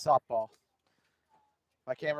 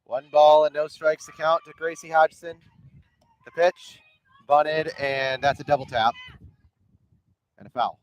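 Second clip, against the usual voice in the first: play-by-play; 3 semitones higher, 6 dB louder than usual. Acted. Live softball play-by-play.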